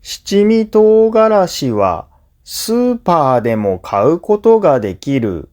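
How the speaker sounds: usual speaking voice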